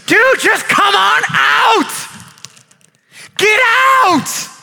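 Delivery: high, loud, drawn-out calling; shouted plea. Acted. People outside the tent beg him out, shouted plea. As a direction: call urgently to someone inside. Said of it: "everybody outside of the tent saying".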